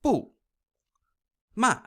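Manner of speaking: "bu" and "ma" start high, drop sharply; quick karate-chop fall on "bu" and "ma". Shown as a demonstration. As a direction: chop "bu" and "ma" like commands. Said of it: "this is the karate chop one".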